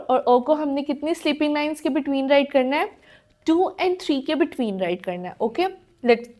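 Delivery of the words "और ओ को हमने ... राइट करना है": usual speaking voice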